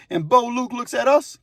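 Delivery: usual speaking voice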